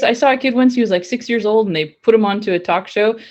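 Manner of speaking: ordinary delivery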